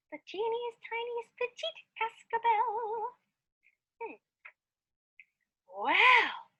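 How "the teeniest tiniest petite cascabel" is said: higher, slower and softer for the tiny bell. Acted. Describing a wee little bell, tiny high voice. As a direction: shrink the voice down small.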